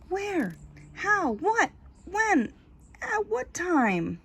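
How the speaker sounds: high, startled questions from the crowd; confused questions. A narrator's reading. Frightened townspeople asking each other what happened, high and confused. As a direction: fire off bewildered questions. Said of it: "Confused questions filled the air".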